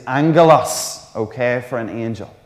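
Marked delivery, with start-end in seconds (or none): 0.1-1.0 angelos said with a joking French accent; mock French accent. Shown as a demonstration. Preacher jokingly shows off angelos in a French accent. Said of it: "impress you with my French accent here".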